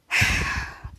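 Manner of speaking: long breathy sigh; acted sigh. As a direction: let out a long imitated sigh. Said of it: "he was like".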